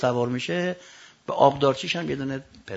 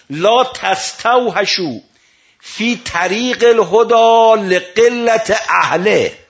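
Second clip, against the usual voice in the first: slow, louder recitation, every Arabic word stretched; solemn recitation; 6 semitones higher, 9 dB louder, much slower than usual. Acted. Preacher reciting Imam Ali's words to the mourners, slow and loud. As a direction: intone the saying slowly and gravely, then carry the same weight into the translation.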